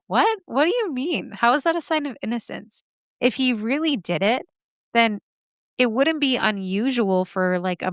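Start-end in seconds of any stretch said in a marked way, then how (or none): 0.1-2.8 high-pitched, disbelieving questions; incredulous reaction. Acted. Host scoffing at the defense's innocence claim, incredulous. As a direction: pitch leaps up on "What?", then eases in disbelief. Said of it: "I'm laughing because it's like".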